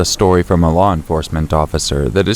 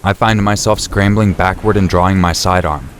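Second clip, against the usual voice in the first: ordinary delivery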